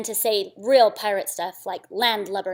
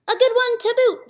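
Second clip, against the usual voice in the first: high loud chorus of pirates; crew agreeing; 13 semitones higher, 6 dB louder than usual. Acted. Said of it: "the others agreed".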